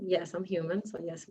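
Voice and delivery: usual speaking voice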